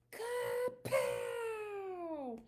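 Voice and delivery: high, punchy sound-effect word; excited kapow. A narrator's reading. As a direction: burst it out like a comic-book blast. Robot hits fireball.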